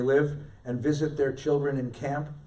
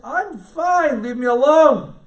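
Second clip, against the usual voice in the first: put-on higher, louder irritated old man; mock snap; 12 semitones higher, 11 dB louder, slower than usual. Anxious old man telling the counselor to leave, mock snap. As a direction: push the young man away.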